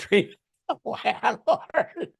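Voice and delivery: laughing through it, slow; words squeezed out through a laugh; real laughter breaking the phrase. Real, not acted. Laughing at litigant's story.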